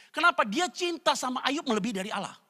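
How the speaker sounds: ordinary talk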